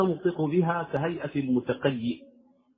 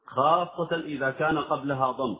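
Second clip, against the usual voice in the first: ordinary talk, nothing marked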